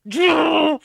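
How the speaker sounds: very slow, high, loud drawn-out yell; mocking MAGA callers with a high drawn-out shout; mock rage shout. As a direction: bellow the name like a raging troll. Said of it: "I'm gonna use their tactics against them".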